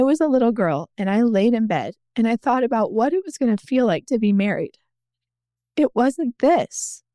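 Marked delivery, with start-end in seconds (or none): 5.8-7.0 slow, higher, holding back tears; close to tears. Real, not acted. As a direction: say it slowly, on the edge of crying. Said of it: "It's probably gonna make me cry again".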